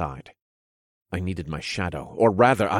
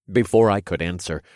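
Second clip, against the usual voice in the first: plain reading voice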